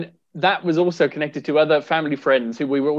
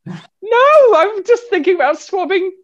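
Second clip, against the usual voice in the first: laughing protest; 6 dB louder, slower than usual. Real, not acted. Laughing denial to co-host's teasing.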